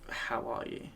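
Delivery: soft, hushed check-in; personal, concerned. Acted. Asking someone how they truly are, soft and personal. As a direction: ask it gently, wanting a real answer. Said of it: "ask them in a more personal way".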